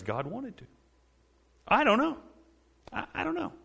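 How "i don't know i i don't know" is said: puzzled shrug. Real, not acted. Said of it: "shrugs, pitch high".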